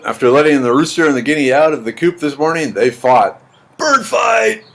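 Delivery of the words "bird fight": high-pitched playground cry of a brawl; mock fight shout. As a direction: call out the fight in a high, playful yell. Playfully announcing a rooster fight.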